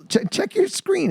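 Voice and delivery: put-on high, slow old man urging him on; old guy voice. Old guy tells the narrator to look at the screen, high and slow. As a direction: stammer it out as the excited old man. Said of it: "he goes".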